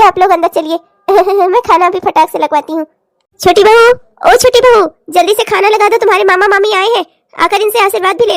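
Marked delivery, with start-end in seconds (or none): 3.4-4.9 calling out. Acted. Mother-in-law calls out loudly for the new bride. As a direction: call louder and higher down the house.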